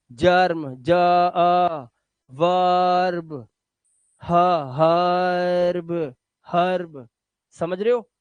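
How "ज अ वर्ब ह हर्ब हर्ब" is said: हर्ब and वर्ब stretched with the अ sound; teacher modelling वर्ब, हर्ब very slowly. Shown as a demonstration. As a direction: drag out वर्ब and हर्ब so the अ is heard. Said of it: "अ की आवाज".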